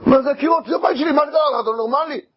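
high, shocked shouting at the intruder; outraged homeowner. Acted. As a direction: yell at the man wrecking your walls. Homeowner confronts axe man. (בעל בית צועק בזעם על ההורס)

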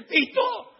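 high, loud answering shout; excited loud hail; calling back. Acted. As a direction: call the name out across a crowd. Calls back to the brother.

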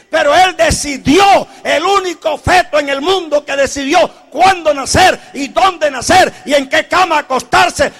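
loud, ringing preacher's proclamation; preaching at full force. Acted. Preacher to the congregation about Jesus' birth, shouting in triumph. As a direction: build it up and shout it to the rafters.

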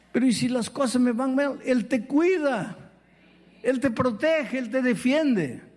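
impassioned pulpit delivery of assurance; fervent preaching. Acted. Preaches with rising fervour.

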